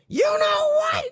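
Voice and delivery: very high squawking mock rant; hammed-up shrieky tirade dissolving into nonsense; woman going off. Line cutter yelling out her car window, mock going off. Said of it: "this lady's going off".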